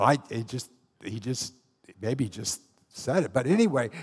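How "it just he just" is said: normal talking voice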